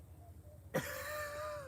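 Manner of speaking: breathless high giggle. Real, not acted. Vlogger recalling her lips, giggling high.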